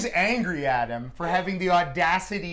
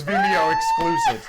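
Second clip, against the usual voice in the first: mock shout. Joking shout, high pitched.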